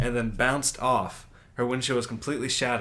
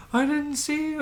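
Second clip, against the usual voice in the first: much higher mock voice of a shaken woman; upset woman driver. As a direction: pitch way up to play the shaken driver.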